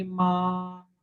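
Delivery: माँ held long on one level pitch; first tone, flat. Shown as a demonstration. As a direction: draw out माँ evenly without any rise or fall. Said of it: "flat पढ़ेंगे".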